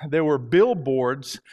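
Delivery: ordinary delivery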